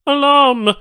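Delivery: high, loud alien yell; alarm shout. A narrator's reading. Banu tech shouting for help, loud alien yell. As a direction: bellow the alarm.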